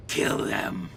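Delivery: low menacing alien voice; menacing reptile alien. Acted. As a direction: growl the order low. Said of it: "And the larger one says".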